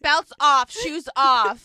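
loud airport security command; mock screaming. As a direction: shout orders like a security officer. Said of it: "who's just screaming at you".

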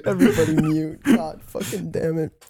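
ordinary delivery

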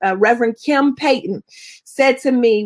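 usual speaking voice

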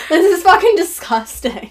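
disgusted. Real, not acted. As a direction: spit it out in disgust. Reacting to deer bones.

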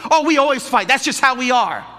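mock complaining couple voice. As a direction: toss it off like it's no big deal. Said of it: "these couples when I counsel them".

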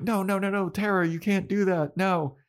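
high, urgent stream of no's; mock panicked pleading. Willow stops Tara, acted.

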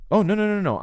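hammed-up hasty denial, pitched well up; mock protest. Voicing an imagined objector's protest.

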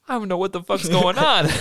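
high, loud mock bewilderment; retold exasperated confusion; baffled exclamation. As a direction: act out a clueless dad caught off guard. Dad baffled by the fight.

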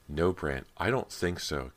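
ordinary delivery

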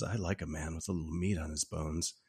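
low, soft retold seduction; sultry come-on. Neighbor flirting with Jack about flannel, low and sultry.

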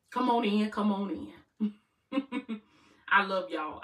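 ordinary delivery

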